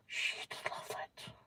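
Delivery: gasp, then whisper it in delight; excited whisper. Real, not acted. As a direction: breathe it out like a secret treat. Excited reading a British menu.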